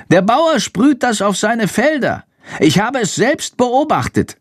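high-pitched small creature voice; explaining bat. A narrator's reading. Bat reports on the farmer's spraying, high explaining voice.

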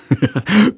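high giggling burst of laughter; belly laugh. Acted. Boy laughs after being thrown out, giggling. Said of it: "hält sich den Bauch vor Lachen".

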